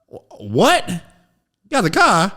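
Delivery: put-on high, loud disbelieving child voice; shocked kid. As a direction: gasp it out like a stunned five-year-old. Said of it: "child answers dad".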